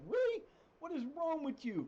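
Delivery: very high-pitched, exasperated disbelief; incredulous scolding. Acted. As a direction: squeak it out in mock disbelief. Mock scolding of touchy players.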